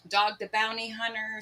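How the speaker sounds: ordinary delivery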